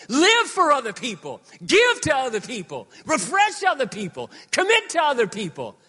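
loud, high, driving sermon shout; full-force preaching. Acted. Pastor exhorting the congregation, shouting high. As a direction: preach it out to the whole room, full voice.

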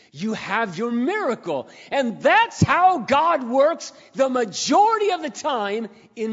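slow, high, loud preaching build; preacher at full force. Acted. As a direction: proclaim it slowly to the congregation. Preaching climax about God.

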